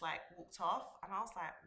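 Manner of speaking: usual speaking voice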